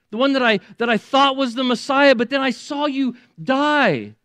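high, loud, breaking with joy; Mary crying out that Jesus lives; overjoyed recognition. Retold or imitated. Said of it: "we would say it this way today".

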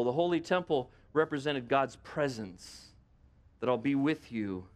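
normal talking voice

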